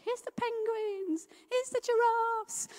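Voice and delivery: high, slow storybook voice; reading to a little girl. Retold or imitated. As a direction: read it out high and slow, like to a toddler. Reading picture book to girl.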